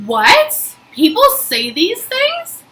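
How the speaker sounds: high, rising disbelief; slow, stunned question climbing high at the end; incredulous. Retold or imitated. Reacting to a stranger's hateful message, incredulous.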